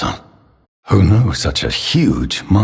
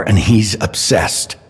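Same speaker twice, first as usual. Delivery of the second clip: ordinary delivery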